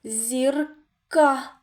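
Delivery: "Зірка" as ЗІР, pause, crisp КА; split syllables. Shown as a demonstration. Teacher shows the next sound, К set apart in "Зірка". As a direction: break "Зірка" before a distinct К. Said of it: "Наступний звук К".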